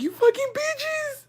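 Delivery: high, drawn-out squeal of retold outrage; re-enacted high-pitched outburst; mad. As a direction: act out being mad, pitch shooting way up. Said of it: "I was so mad".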